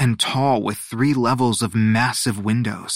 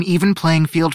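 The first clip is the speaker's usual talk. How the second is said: higher, projected official statement; earnest assurance; 5 semitones higher, 4 dB louder than usual. A narrator's reading. Luna promising competitors fairness, earnest assurance. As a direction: declare it firmly to the whole group.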